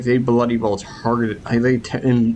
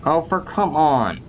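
frustrated groan; 6 semitones higher than usual. Real, not acted. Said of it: "that makes me angry".

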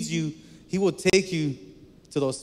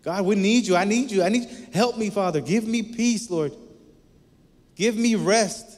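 higher and louder, crying out to God; urgent prayer to the Father, raised and pleading; 6 semitones higher, 3 dB louder than usual. Shown as a demonstration. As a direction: beg for help with your whole voice. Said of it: "Call on him".

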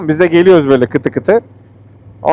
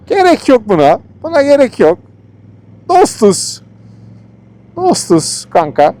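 high pleading; 10 semitones higher, slower than usual. Real, not acted. Pleading with dogs chasing them.

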